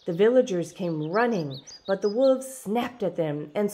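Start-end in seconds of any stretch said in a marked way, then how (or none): none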